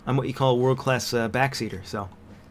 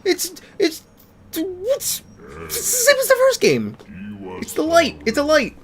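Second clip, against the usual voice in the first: excited; 5 dB louder than usual. Real, not acted. Excited discovery in game.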